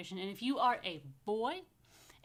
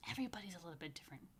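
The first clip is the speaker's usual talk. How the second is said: whisper; 3 semitones lower, 9 dB quieter, much faster than usual. Real, not acted. Rabbi whispering a lesson to the children. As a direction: lean in and whisper it fast.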